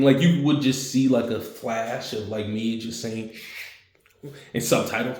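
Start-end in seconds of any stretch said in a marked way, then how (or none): none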